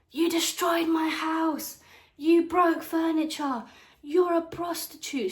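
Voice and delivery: high, slow mock-outraged aunt; imitating angry aunt. Retelling aunt's screaming accusations, high slow mimicry. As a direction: spit each accusation out slowly in her voice. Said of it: "she starts going off on me and she's like".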